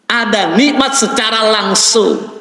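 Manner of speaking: usual speaking voice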